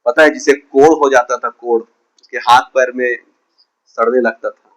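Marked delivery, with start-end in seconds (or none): none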